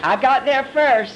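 teasing little boast; mock boasting. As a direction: lightly crow it like a competitive disciple. John boasting, acted by preacher.

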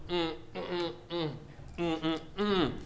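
quiet, closed-mouth straining hums; suppressed humming struggle. Shown as a demonstration. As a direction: fight it silently behind closed lips. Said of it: "don't sit there in silence and just go".

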